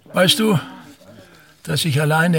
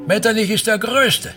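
grandiose pride; 6 semitones higher than usual. Acted. Grandiose boast.

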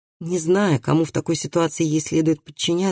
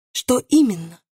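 pitched up, short eager question; quick question; 6 semitones higher than usual. A narrator's reading. Bailiff jumps on the witness's hint, quickly. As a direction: ask it quickly, pouncing on the answer. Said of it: "быстро спросил судебный пристав".

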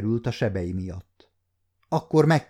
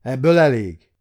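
loud, higher royal outburst; thundering rebuke; 4 semitones higher, 7 dB louder than usual. A narrator's reading. King cutting off the quarrelling lovers, thundering. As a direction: thunder at them to silence them. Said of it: "dörrent rájuk Károly király".

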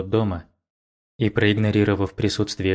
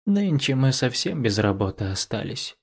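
put-on a touch of a languid older woman; sultry woman; 3 semitones higher than usual. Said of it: "томно протянула".

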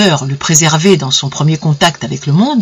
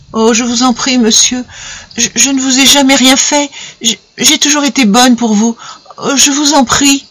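higher, fragile pleading voice; pleading woman; 7 semitones higher than usual. Acted. Gilberte begging Simard in the dark, higher and pleading. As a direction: beg him to let go, frightened. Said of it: "Elle dit simplement".

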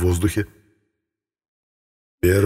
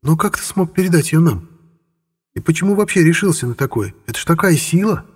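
shocked; 8 semitones higher than usual. A narrator's reading. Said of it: "демонесса в шоке".

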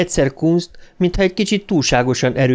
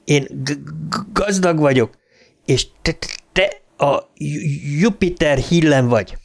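higher voice, stammering hard on first consonants; stuttering ghost boy; 4 semitones higher than usual. A narrator's reading. A ghost boy mocking Bowers at Juniper Hill, stuttering. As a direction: taunt him through a heavy, blocked stutter.

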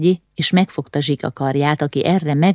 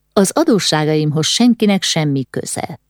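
ordinary delivery, nothing marked

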